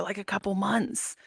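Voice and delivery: rough, strained voice that has nearly gone; raspy lost voice. Shown as a demonstration. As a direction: croak it out like you have lost your voice. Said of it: "talking like this".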